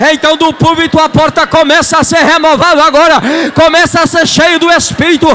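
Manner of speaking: high, loud revival proclamation; full-force preaching. Real, not acted. Preacher declaring God's presence from the pulpit, full-force preaching. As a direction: push each clause out to the whole church.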